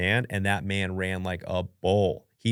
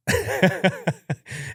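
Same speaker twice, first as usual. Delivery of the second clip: embarrassed high laugh; 11 semitones higher than usual. Real, not acted.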